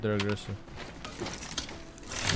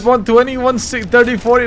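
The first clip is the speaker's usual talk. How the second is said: auctioneer; 10 semitones higher, 7 dB louder than usual. Real, not acted. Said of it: "It's like an auctioneer".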